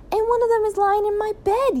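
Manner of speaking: put-on high-pitched indignant boy; outraged yell. A narrator's reading. Son seeing a duck in his bed, yelling high. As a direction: yell it high, like a wronged child. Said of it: "yelled William".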